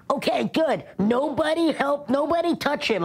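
mock take-charge order to the crowd; bossy bystander. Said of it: "He's like".

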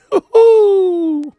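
a sudden high burst of laughter. Real, not acted. Preacher laughing at the commenter's insult, high laugh.

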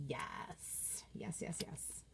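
breathy whispered yeses; whispering. Real, not acted. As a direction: whisper it to yourself. Excited agreement to herself.

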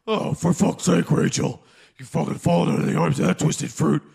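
ordinary talk

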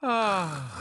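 ordinary talk

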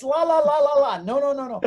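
hammed-up frantic la la la; panicked Arabic no. Counselor begging him not to call, loud mock panic. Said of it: "In, in Arabic, it's".

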